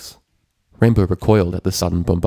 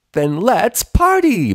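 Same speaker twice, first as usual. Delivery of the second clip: much higher, loud, stretched squeal; 14 semitones higher, 5 dB louder, much slower than usual. A narrator's reading. Pinkie starting the party, excited squeal. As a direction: squeal it with glee. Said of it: "Pinkie squealed".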